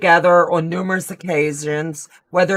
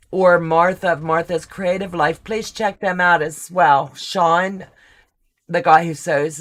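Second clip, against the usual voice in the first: usual speaking voice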